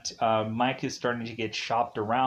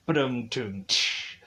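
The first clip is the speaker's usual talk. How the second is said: vocal drum sting after a pun; mock rimshot; slower than usual. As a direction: imitate a joke drumroll and cymbal.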